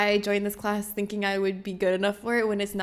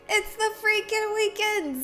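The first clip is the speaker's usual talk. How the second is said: higher, drawn-out weekend cheer; party-starting hype; excited announcement; 10 semitones higher than usual. Real, not acted. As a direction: celebrate the weekend at a high pitch.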